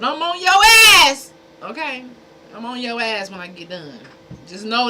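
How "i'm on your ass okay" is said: high, loud threat at the trolls; threatening yell. Real, not acted. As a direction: bark it out, suddenly much higher and louder. Threatening trolls in his chat.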